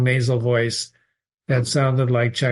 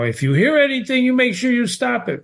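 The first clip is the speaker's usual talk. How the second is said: quoted warning; 10 semitones higher than usual. Retold or imitated. Teacher voices Alex's warning.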